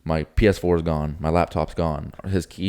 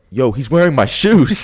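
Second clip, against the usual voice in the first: higher, louder, slow disbelief; shocked realization; 8 semitones higher, 10 dB louder, slower than usual. Retold or imitated. Retelling spotting the thief's shoes, shocked and loud. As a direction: spot it and blurt it out. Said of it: "I'm like".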